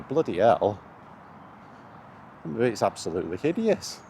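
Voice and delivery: ordinary delivery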